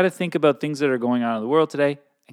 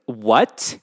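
attitude; 2 semitones lower, 4 dB louder than usual. Retold or imitated. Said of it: "giving me attitude".

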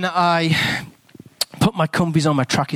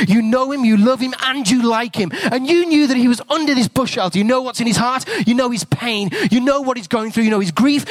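fervent prayer; 8 semitones higher than usual. Acted. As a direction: pray over him with rising fervour. Prays aloud over Derek.

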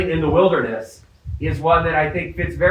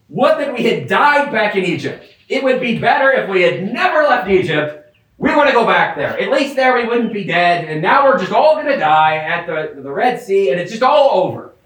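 raised, whiny lament of the crowd; complaining Israelites; 4 semitones higher than usual. Retold or imitated. Preacher voicing grumbling Israelites, whiny lament. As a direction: moan like a doomed grumbling crowd. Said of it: "they start to say".

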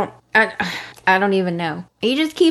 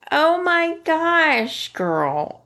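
usual speaking voice

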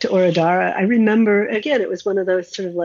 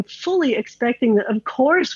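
ordinary delivery

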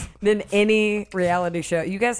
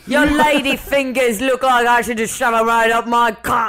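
loud, hammed-up British accent; mock British shouting; 4 semitones higher, 7 dB louder than usual. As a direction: bellow it as an outraged Brit. Riffing as angry British bakers.

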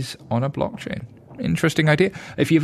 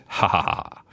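low mock evil cackle; maniacal laugh; 5 semitones lower, 3 dB quieter than usual. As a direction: cackle like a cartoon villain. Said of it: "Did you like my maniacal laugh there?".

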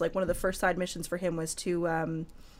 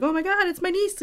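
much higher, thrilled greeting; excited uncle; 11 semitones higher, 3 dB louder than usual. Retold or imitated. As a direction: gush over a long-lost niece. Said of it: "he gets really excited".

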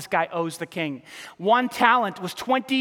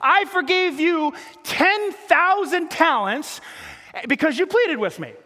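higher, slow, stretched reproach; indignant master; 7 semitones higher, slower than usual. Acted. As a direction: lay out the debt forgiven. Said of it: "his master ... said to him".

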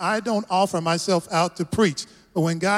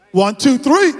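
rallying count, louder on each number; 6 semitones higher, 8 dB louder, slower than usual. Real, not acted. Pastor counting the congregation in for a shout, loud. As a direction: count them in, loud and rising.

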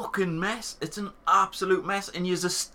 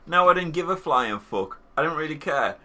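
usual speaking voice

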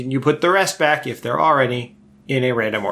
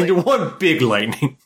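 infomercial voice; 3 semitones higher than usual. Shown as a demonstration. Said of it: "this sounds like an infomercial sales pitch".

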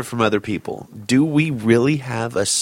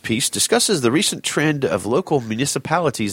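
higher-pitched, formal read-aloud; pitched-up voice for an official question; professional reading voice; 4 semitones higher than usual. Pretend. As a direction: put on a voice to sound professional. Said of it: "Why'd you read it in that voice?".

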